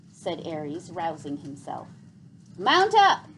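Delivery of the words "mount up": loud rallying call, pitched up. A narrator's reading. Gregor calls the bats to take off, loud call. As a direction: shout it across the ledge to the riders. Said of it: "called Gregor".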